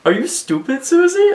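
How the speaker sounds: high, mocking put-on voice rising to a squeak on the name; scornful Susie impression. Susie's rival mocking her, high and scornful. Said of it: "be like".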